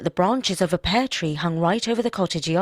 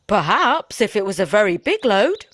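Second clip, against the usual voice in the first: crafty; 3 semitones higher, 6 dB louder than usual. Acted. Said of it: "answered the woodman craftily".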